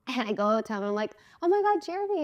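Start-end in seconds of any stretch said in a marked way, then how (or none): 1.4-2.2 high-pitched gushing hello; excited greeting. Retold or imitated. Woman greeting her crush in the hallway, excited. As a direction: greet him in a high, thrilled voice.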